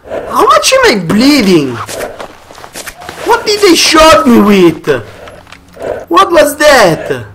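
high, loud alarmed questions; rattled after getting shot; panicked. Real, not acted. Gamer just shot, panicking aloud.

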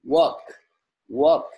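"work" said high and loud, rising; pron drill. Shown as a demonstration. Teacher modelling "work" loudly to pupils. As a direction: stretch up "work" with a final lift. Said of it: "Ở đây có từ work".

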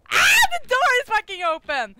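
fast, loud frightened shout; startled gamer yelling at the screen; panicked yell. Real, not acted. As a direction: blurt it out in real panic. Panics at open door in game.

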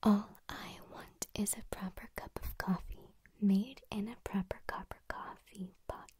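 crisp whispered twister at an even pace; steady pace. Shown as a demonstration. ASMR host whispering the coffee twister at normal speed. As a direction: enunciate every p and c in a loud whisper. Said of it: "say that just a little bit faster".

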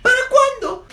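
high-pitched nosy aunt; loud, prying old aunt. Acted. Nosy aunt at party skit.

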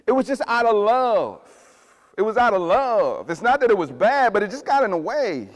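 loud, high preaching, pressing every line; full-force preaching. Acted. Pastor preaching loud to his church about first love. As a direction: preach it hard to the room.

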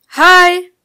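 loud, high, stretched-out hi; drawn-out call. A narrator's reading. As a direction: call it long down the hallway. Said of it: "rief sie langgezogen in den Flur".

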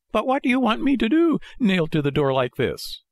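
very high puppet protest, easing down; high-pitched, helpless complaint; exasperated Pinocchio. A narrator's reading. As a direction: protest in a small, squeaky voice.

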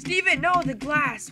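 high, loud cry of alarm; alarmed warning. Real, not acted. Player warning Steven about glass, high alarmed shout. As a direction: call out a sudden warning.

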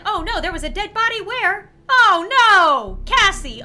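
high, loud mock dismay; pretend shock. Lizzie faking innocence over the body, mock shocked. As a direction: act shocked with theatrical cries. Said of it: "She was pretending like she was shocked".

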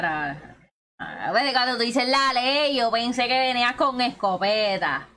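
normal talking voice

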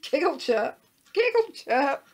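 high, loud chirpy repeats; squeaky little bird giggle-words; giggling chirping bird. A narrator's reading. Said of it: "giggling and chirping at the same time".

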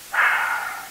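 long rasping exhale; heavy rattling sigh over radio; deep sigh. Acted. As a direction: breathe out long and strained. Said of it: "He sighed deeply, the long exhale".

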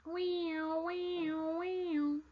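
high, sustained wailing siren noise; voiced siren, swooping in repeated waves; imitated fire engine siren. A narrator's reading. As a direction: wail like a fire engine rushing in.

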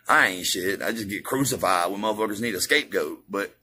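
normal talking voice

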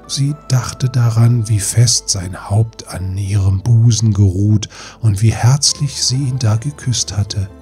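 ordinary delivery

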